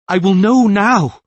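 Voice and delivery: loud, high, slow defiance; defiant. Acted. Tris defying the possessing voice. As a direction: refuse to be put off.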